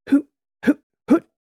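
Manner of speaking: high, loud clipped hoots; three hard drill breaths, voiced high; forceful breathing hoots. A narrator's reading. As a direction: fire out each hoot separately. Breathing drill during imagined drop.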